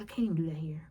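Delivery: whispered aside; under her breath. Retold or imitated. Muttering to herself at the scene, whispered. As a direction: whisper it to yourself. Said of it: "talking under your breath".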